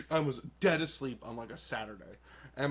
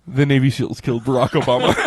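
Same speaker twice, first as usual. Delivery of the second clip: loud deadpan; 2 semitones lower, 13 dB louder, faster than usual. Retold or imitated. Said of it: "And, like, just".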